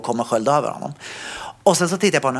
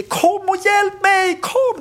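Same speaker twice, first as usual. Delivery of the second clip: retold shout for help; much slower than usual. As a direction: yell for strangers to come and help. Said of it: "Så jag bara skriker".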